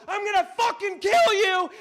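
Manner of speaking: high furious yell; imitating an enraged pursuer; yelled threat. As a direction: scream a threat while giving chase. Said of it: "running behind me yelling".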